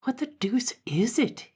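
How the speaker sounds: slow, high-pitched wondering; drawn-out, theatrical puzzlement; puzzled dandy. Acted. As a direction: ponder aloud as a baffled dandy.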